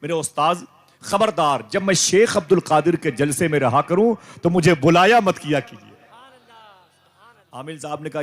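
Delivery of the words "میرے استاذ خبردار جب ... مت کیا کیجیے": higher and louder, a roaring warning; a jinn thundering at his master to leave him be. Retold or imitated. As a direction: roar it at the master like an angry jinn. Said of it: "اور گرج کے بولا".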